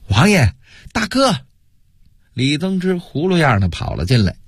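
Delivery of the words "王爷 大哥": high-pitched urgent hail; distant calling. A narrator's reading. Messenger hailing his lord from outside, distant calling. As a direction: call out as if from beyond the gate. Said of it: "远方传来了一阵呼声".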